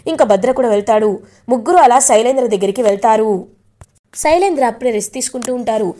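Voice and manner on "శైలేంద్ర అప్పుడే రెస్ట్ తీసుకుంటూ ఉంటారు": ordinary delivery, nothing marked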